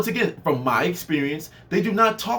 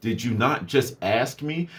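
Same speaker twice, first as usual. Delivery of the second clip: deadpan literal; 7 semitones lower, slower than usual. Retold or imitated. Deadpan reply to boss.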